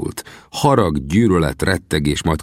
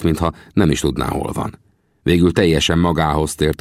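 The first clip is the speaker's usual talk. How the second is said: usual speaking voice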